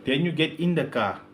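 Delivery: normal talking voice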